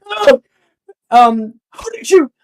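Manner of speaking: very high, loud stammering shock. Acted. Second thug reacts to the spell, very high and shocked. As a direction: stammer in disbelief at what she did.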